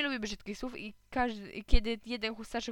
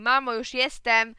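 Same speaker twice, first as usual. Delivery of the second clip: loud, drawn-out call home; shouted; 3 semitones higher, 10 dB louder, slower than usual. A narrator's reading. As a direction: shout to mum that you're in. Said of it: "krzyknąłem". (głośne, przeciągłe wołanie)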